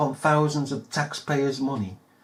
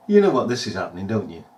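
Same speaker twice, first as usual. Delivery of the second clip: ordinary delivery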